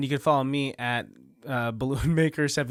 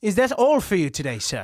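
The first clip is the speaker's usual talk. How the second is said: put-on British accent; 3 semitones higher than usual. Cashier actor asked for a British man, British accent. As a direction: lay the British accent on thick. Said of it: "more like a British man".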